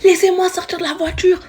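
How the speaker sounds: high-pitched hammed-up cry to get out; mock crazy yelling. To the boys driving her off, a high mock scream to get out. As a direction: play the madwoman demanding to be let out. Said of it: "Je commence à crier".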